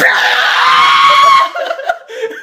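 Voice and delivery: very high, very loud long shriek; sudden loud scream. Acted. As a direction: blast out a shrieking roar. Acting the attack that splatters him.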